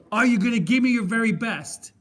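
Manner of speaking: loud, hammered coach's challenge; demanding coach. Retold or imitated. Film coach retold, loudly demanding a yes. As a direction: demand commitment like a football coach.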